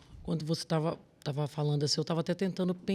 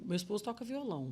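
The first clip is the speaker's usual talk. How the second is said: normal talking voice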